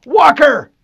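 high, loud shout of a name. A narrator's reading. Harlan shouting for Walker under fire. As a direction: yell for him across the vehicle. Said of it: "Harlan shouted".

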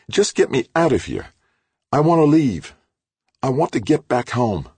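high and loud, pleading hard; desperate demand. A narrator's reading. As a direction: demand to be let out. Halleck demanding to leave.

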